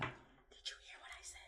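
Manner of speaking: hushed whisper to a co-host; whispered aside. Real, not acted. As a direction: whisper it off to the side. Aside to co-host, ignored remark.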